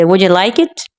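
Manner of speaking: yes-no question rising up to "it"; rising intonation. Shown as a demonstration. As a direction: lift the voice at the end on "like it". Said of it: "rising intonation है वो ज़्यादातर general questions पूछने". (like it पर ऊपर उठता सुर)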